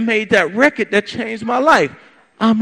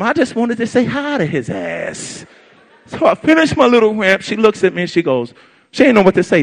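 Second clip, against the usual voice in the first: usual speaking voice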